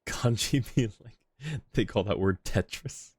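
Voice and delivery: ordinary talk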